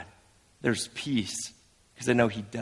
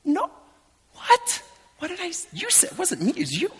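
high, hushed comic panic; Peter backpedalling. Acted. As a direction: squeak out nervous denials.

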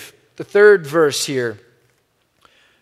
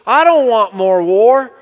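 higher and louder, a resonant preaching cadence; imitating John Perkins; 4 semitones higher, 7 dB louder than usual. Pastor retelling a civil rights elder's plea to leaders, higher, louder impression. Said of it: "He goes".

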